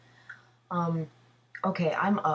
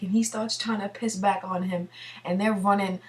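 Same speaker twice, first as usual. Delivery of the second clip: ordinary delivery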